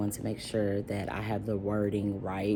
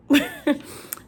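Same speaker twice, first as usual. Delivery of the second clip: a high loud burst of laughter; real laugh; 6 dB louder than usual. Real, not acted. Telling about the bilingual student, high burst of laughter.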